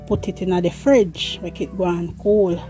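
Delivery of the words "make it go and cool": ordinary talk, nothing marked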